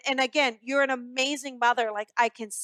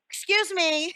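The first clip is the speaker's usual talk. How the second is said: higher, playful mock cat voice; cat's voice; 5 semitones higher than usual. Medium voicing the late cat to its owner, higher mock cat voice. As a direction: pipe up like a cat demanding notice. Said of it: "Cat's like".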